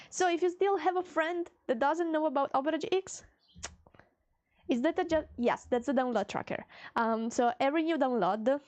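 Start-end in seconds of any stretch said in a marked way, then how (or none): none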